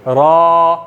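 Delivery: रॉ slow with a trilled tongue; रॉ with the tongue vibrating, drawn out slowly; vibrated ra. Shown as a demonstration. Teacher showing the Arabic trilled ra. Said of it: "ज़बान आपकी यहाँ थोड़ी सी vibrate करेगी".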